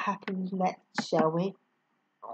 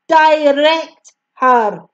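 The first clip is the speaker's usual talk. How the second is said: louder, slow and emphatic; firm command; 5 semitones higher, 9 dB louder, slower than usual. A narrator's reading. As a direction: hammer out the order. Teacher's firm order.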